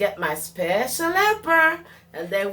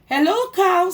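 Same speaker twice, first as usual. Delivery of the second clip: high bright chirpy hello; cheery duck greeting; 11 semitones higher, 6 dB louder than usual. Acted. Duck calls out to Cow as he rides past, high and cheery. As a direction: greet like a happy little duck on a bike.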